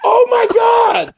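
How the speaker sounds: high, loud, drawn-out shout; a slow, loud cry of dismay; shocked exclamation. Retold or imitated. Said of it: "I was like".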